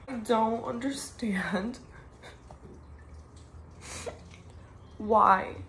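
slow, broken whisper through tears; whispering through tears over her friend's death; tearful whisper. Real, not acted. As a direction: barely get the words out, crying.

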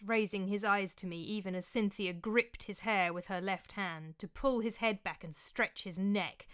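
usual speaking voice

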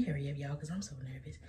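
usual speaking voice

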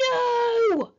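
loud, high tantrum wail; screamed refusal. A narrator's reading. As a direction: scream it like a furious boy. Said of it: "screamed Henry".

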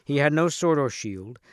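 usual speaking voice

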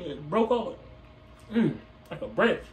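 chewing while talking; biting into fry; mouth full. Real, not acted. Seen 0: holds curly fry speaking, bites it ~1.7s, then chews afterwards.

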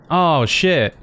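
normal talking voice

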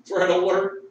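playful mock siren call; hammed-up red alert. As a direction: shout the alarm for the laugh. Repeat, raised.

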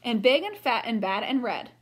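lively read-aloud storybook voice; reading to children. A narrator's reading. Teacher reading a picture book to the class, lively. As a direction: read it brightly for young listeners.

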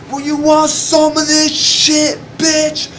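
very high, slow, unhinged yell; retold fight taunt in a wild high yell. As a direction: dare him, bleeding and half-crazed.

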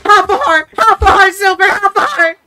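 high, loud alarmed yelling; panicked shouting. Real, not acted. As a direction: yell it out in panic. Near death, yelling at teammates.

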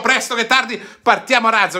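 high, punchy catchphrase call; excited catchphrase. Real, not acted. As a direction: launch it like a rocket. Signature catchphrase opener.

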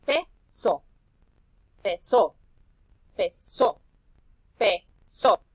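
"Peso" split loud into PE-SO; clapped syllables. Shown as a demonstration. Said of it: "La voy a separar en sílabas". ("Peso" separado en sílabas con palmadas)

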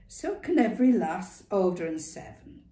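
lightly voiced old Northern woman; brisk, knowing old woman. A narrator's reading. Ma Duckworth voice.

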